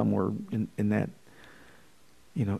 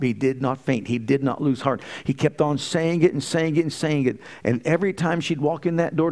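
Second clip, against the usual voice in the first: ordinary delivery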